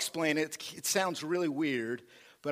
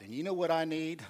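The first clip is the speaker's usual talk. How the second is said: ordinary talk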